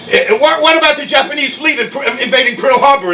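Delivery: high-pitched, stumbling question; imitated reporter. Reporters questioning Trump at a rally, imitated in high voice. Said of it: "reporters ask him".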